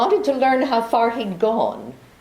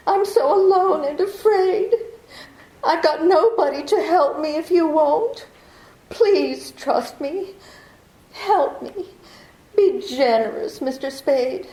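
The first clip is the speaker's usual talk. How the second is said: high-pitched, tearful begging with a throb in the voice; throbbing plea; 5 semitones higher than usual. Acted. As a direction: plead helplessly, voice throbbing. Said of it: "that throb you get into your voice".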